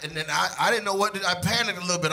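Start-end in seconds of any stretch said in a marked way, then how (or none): none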